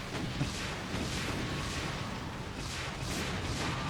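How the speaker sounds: unvoiced exasperated wheeze of a laugh; breathless laugh. Real, not acted. Laughing in frustration.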